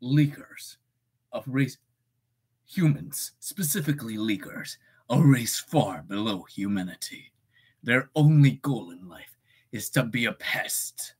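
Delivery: lightly voiced Satan, low and menacing; a hint of a demon sneering at leakers; Satan line. Streamer doing his Satan character line, lightly menacing. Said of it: "I'll do the fucking Satan line".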